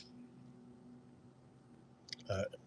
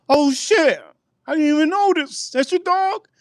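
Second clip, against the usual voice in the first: much higher pitched, startled stranger voice. Retold or imitated. Retelling the guy who rolled up, scared high stranger voice. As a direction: squeak it out like a spooked stranger backing away.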